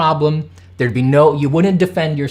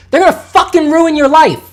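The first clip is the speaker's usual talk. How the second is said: much higher and louder furious yell; furious shout; 13 semitones higher, 8 dB louder than usual. Real, not acted. Streamer warns artists in a furious shout. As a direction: hurl it as a warning in real anger. Angry rant climax.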